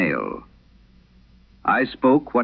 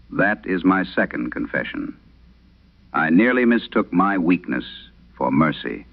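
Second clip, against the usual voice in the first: ordinary delivery, nothing marked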